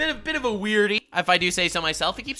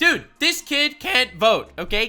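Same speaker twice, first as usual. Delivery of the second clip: emphatic loud rant; 5 semitones higher, 7 dB louder than usual. Real, not acted. Cohost insists to the viewer the boy is only a child, loud and emphatic. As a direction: pound out every point. Indignant rant about the kid.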